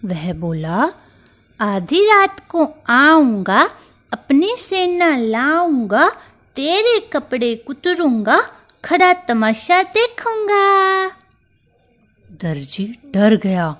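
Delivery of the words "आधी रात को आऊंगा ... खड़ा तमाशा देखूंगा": high, loud mouse threat, rising at each line end; a furious little mouse vowing revenge; angry threat. Acted. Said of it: "Chunky को गुस्सा आ गया".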